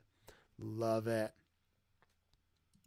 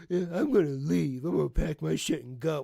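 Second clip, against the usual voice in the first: louder, put-on voice mocking the son's threat; mocking whiny quote; 2 semitones higher, 6 dB louder than usual. Streamer mocking the son's threat to leave, louder put-on sneer. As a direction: sneer it back as if he's a pouty kid.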